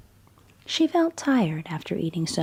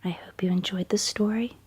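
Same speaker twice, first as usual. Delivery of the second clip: whispered bedtime story. A narrator's reading. Storyteller reading Goldilocks at bedtime, in a hushed whisper. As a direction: whisper the tale as if a child is drifting off.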